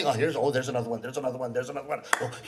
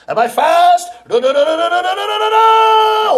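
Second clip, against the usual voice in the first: rising higher and louder to a shout; building excitement; 7 semitones higher, 6 dB louder than usual. Acted. Rickshaw man working himself up, higher and louder to a shout. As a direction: wind up from question to a yell.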